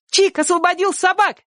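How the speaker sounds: put-on very high, gleeful child's cry; joyful shout. A narrator's reading. Sonka telling neighbour the news, shouting joyfully. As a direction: announce it high, full of delight. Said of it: "радостно крикнула Сонька".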